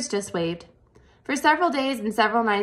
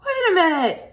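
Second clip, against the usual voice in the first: high, loud desperate cry; Wilbur screaming; 10 semitones higher, 5 dB louder than usual. A narrator's reading. Wilbur screams after the flying spider, high and loud. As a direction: yell after the departing spider. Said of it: "screamed Wilbur".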